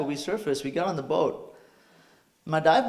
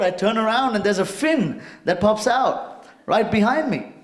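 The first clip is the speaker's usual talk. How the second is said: ordinary talk, nothing marked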